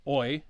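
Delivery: oi said as one gliding sound like foil. Shown as a demonstration. Teacher demonstrating a Latin diphthong. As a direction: blend o and e into oi. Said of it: "you put those together".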